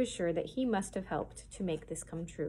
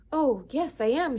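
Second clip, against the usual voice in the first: higher, louder, eager yes; fervent; 6 semitones higher, 5 dB louder than usual. A narrator's reading. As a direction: blurt it out with shining eagerness. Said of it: "she said fervently".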